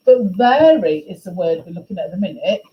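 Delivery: normal talking voice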